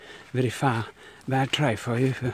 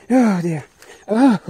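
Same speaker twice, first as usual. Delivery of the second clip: frightened; 7 semitones higher, 9 dB louder, slower than usual. Real, not acted. Frightened again.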